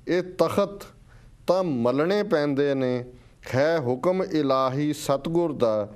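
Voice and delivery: slow, measured verse with swelling, falling line ends; stirring, drawn-out poetry reading; poem recitation. Real, not acted. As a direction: declaim each line deliberately, pausing between phrases. Recites a listener's poem aloud.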